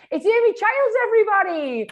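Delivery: loud, high showbiz intro; excited announcement. Real, not acted. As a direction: hype up the guest's name. Host introduces guest with fanfare.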